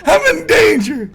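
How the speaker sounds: high, loud, laughing cry for help; mock panic. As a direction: yell for security in fake terror.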